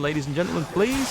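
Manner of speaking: hammed-up crowd-control announcer; mock announcer shout. Gamer mock-addresses swarming ghouls, announcer shout. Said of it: "What are you shouting for?".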